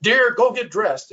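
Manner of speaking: military voice. Retold or imitated. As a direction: bark it out slowly like an ex-soldier husband. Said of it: "with that kind of former military voice".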